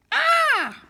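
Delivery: high piercing scream of shock; startled girl's shriek. A narrator's reading. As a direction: shriek at the mirror. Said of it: "Then she screamed".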